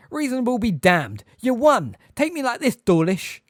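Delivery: loud, high enraged bellow; furious roar. A narrator's reading. Hagrid defying the wizards attacking him, furious roar. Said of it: "Hagrid roared".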